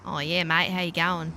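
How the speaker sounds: a hint of a broad Australian Crocodile Dundee accent. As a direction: lightly ham up a broad Aussie bloke. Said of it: "I don't sound like Crocodile Dundee".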